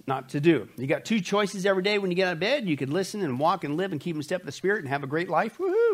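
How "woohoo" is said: excited whoop. Acted. Cheering a great Spirit-led life.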